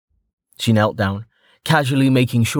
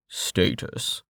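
one cold word; 2 semitones lower, 7 dB quieter than usual. A narrator's reading. Westbridge terse.

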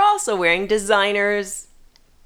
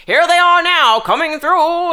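high, loud newsreel announcer; old-timey announcer; 11 semitones higher, 5 dB louder, slower than usual. Retold or imitated. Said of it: "that old-timey voice of saying".

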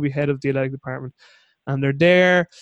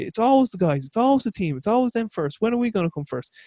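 complaining wife; 6 semitones higher than usual. Retold or imitated. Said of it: "their wife goes".

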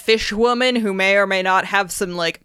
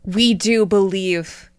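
slow sermon to the ocean; preaching; slower than usual. Acted. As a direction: preach it slowly with conviction. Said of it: "do a snippet of your speech".

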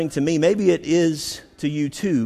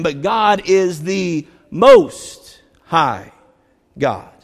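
preaching emphasis; 2 semitones higher, 3 dB louder, slower than usual. Acted. Preacher builds to the climax.

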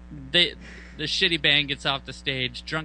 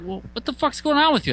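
high and fast, mock-quizzical; bandmates asking; 4 dB louder, faster than usual. Bandmates quizzing the distressed singer, high and fast. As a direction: toss it off high and quick. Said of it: "everyone's like".

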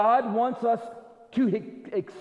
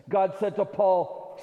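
ordinary talk, nothing marked

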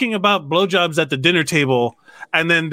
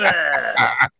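exaggerated heaving vomit noises; mock retching; 3 semitones lower than usual. Son hearing about his parents' sex life, mock retching. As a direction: gag as if about to throw up. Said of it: "I make heaving vomit noises".

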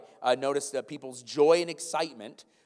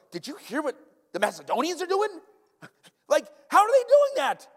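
much higher, laughing disbelief; amazed Corinthians; 14 semitones higher, 7 dB louder than usual. Retold or imitated. Pastor voicing Corinthians amazed at the Macedonians, high laughing disbelief. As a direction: marvel at it, half laughing. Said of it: "they're like".

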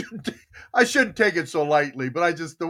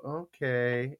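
put-on reluctant child, soft; 4 semitones lower, 8 dB quieter than usual. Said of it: "And she'll go".

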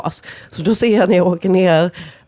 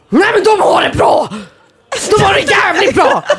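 mock indignant shout; 11 semitones higher than usual. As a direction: snap back defensively at the man. Defending herself to stranger.

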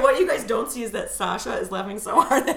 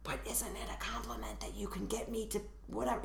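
hushed, much softer quote; whispered quote after the tag; 14 dB quieter than usual. Shown as a demonstration. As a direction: drop into a near-whisper for the quoted line. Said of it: "she should say, "I whispered back".

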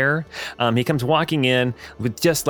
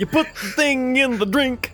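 imitating; 13 semitones higher than usual. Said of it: "I mean, uh, isn't it?".

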